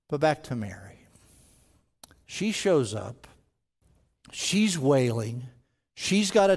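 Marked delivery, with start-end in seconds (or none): none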